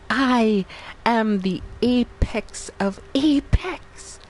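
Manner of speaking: slow, hammed-up villain boast on "I am the apex of apex"; mock demon boast. To chat, boasting as a lightly voiced demon. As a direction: lord it over them like a mock demon.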